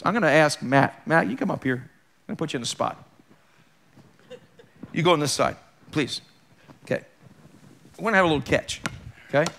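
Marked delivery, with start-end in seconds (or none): none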